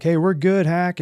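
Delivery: urgent mock shout of reassurance; retold callout. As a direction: call across a cockpit, lightly acted. Calls to pilot in cockpit.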